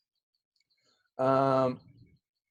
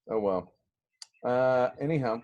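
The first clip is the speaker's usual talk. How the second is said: normal talking voice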